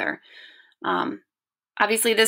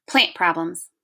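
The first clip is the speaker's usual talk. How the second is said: usual speaking voice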